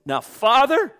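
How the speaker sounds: high, loud appeal rising at the end; pleading call. Acted. The Son appealing to the Father, pleading call.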